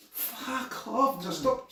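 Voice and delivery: mock bear pleading to be left alone; fed-up bear. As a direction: whine it like the bear has had enough. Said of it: "The bear's like".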